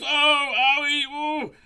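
very high wailing "owie"; worsening pain cries. A narrator's reading. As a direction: howl in comic agony. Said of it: "stomachache only got worse and worse".

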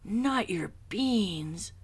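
slow, high, outraged whisper; outraged whisper-cry. A narrator's reading. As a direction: gasp it out in outrage. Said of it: "cried Skipito, outraged".

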